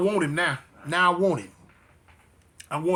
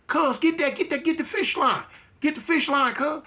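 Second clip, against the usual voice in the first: higher-pitched, lightly acted call; imitated calling out; 6 semitones higher than usual. Prisoner calling to his homies to get the fish line, lightly acted call. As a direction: call down the tier, pitched up without real volume. Calling to inmates along the tier.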